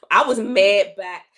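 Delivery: loud, slow, emphatic admission. Real, not acted. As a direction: lean hard into the word mad, slowly. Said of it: "Oh, I was mad".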